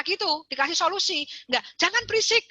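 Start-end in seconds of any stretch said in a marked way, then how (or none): 1.8-2.4 "Jangan berisik." snapped high as a parent's order; stern command. Shown as a demonstration. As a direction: bark "Jangan berisik." at the kid. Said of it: "Itu dogma".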